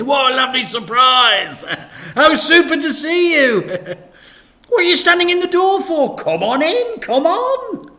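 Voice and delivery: high, loud, laughing welcome; loud hammed-up cheery welcome; fake cheerful. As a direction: gush over the guest. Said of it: "he announced cheerfully".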